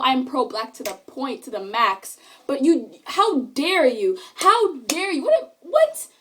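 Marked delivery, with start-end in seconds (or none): 5.2-6.1 squeaked high in disbelief; incredulous what. Real, not acted. Scene: venting to viewers about the locker, a high incredulous what. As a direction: shoot the pitch way up, outraged.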